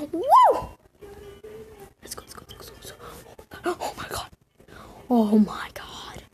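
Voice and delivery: rapid hushed chant; excited whisper. Real, not acted. Gamer chanting at the hatching pet, hushed whisper. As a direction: whisper the hype under your breath.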